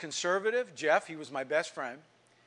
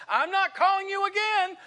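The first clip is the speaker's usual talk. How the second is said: very high mother's holler; imitated yell; 4 dB louder than usual. Mothers calling kids in to eat, yelling. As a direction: call out high and loud like a fed-up mom. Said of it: "they'd have to yell at us".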